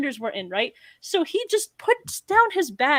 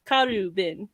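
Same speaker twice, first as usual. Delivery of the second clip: Karubin said in a Japanese accent, with a rolled R; 5 semitones lower, 5 dB quieter, slower than usual. Shown as a demonstration. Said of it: "speak in the Japanese accent".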